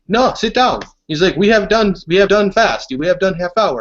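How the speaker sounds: high, loud mock French African accent; poor French African accent. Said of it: "French African accent".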